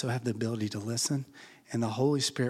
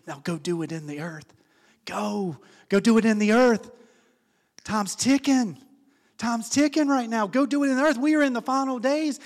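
loud, high urgent call; urgent command; 10 semitones higher, 7 dB louder than usual. Acted. Spirit sending believers out, loud urgent high command. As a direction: fire them up to go now.

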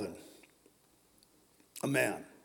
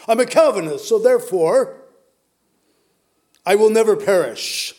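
mock proclamation; 2 semitones higher, 9 dB louder than usual. Preacher mimics a Calvinist sure of salvation, loud mock proclamation. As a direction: declare it loudly as a doctrinaire believer.

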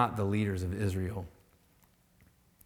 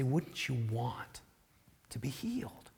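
gentle pleading whisper; 9 dB quieter than usual. Real, not acted. Pastor looks at you and softly asks if you want healing. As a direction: ask it tenderly.

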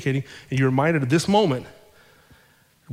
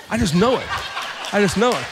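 laughing; 6 semitones higher than usual. Retold or imitated. Husband fearful, laughing in retelling.

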